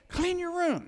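high, loud mock parental command; parent's order. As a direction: call it out like a parent to the kids. Voices parent ordering kids.

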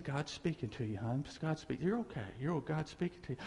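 lightly voiced pastor soothing her; quiet imitation of tender prayer; hushed prayer. Pastor voiced praying over woman.

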